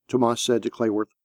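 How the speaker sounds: ordinary delivery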